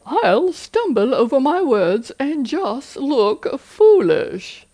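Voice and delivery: a goofy put-on voice; funny voice. Shown as a demonstration. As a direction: poke fun at the worry in a cartoonish voice. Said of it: "use the funny voice technique".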